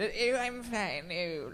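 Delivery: high, slow, stiff Englishman; awkward Englishman. Retold or imitated. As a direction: squeak it out, stiff and uneasy. Imitating awkward English person.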